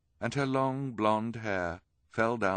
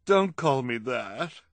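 tearful plea; 6 semitones higher, 8 dB louder, slower than usual. Acted. Crying woman pleading.

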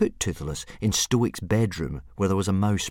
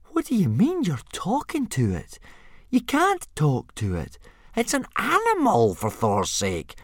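much higher, incredulous protest; astonished disbelief; 11 semitones higher than usual. Acted. Fishlegs protesting you can't talk to animals, incredulous. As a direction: squeal in disbelief at the idea. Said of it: "gasped Fishlegs in astonishment".

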